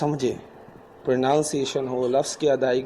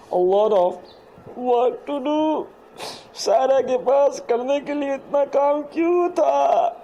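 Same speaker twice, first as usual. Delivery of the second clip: much higher, squeaky playful voice; squeaky mock voice; 10 semitones higher, slower than usual. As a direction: squeak it out like a cartoon. (ऊँची, चूँ-चूँ मज़ाकिया आवाज़)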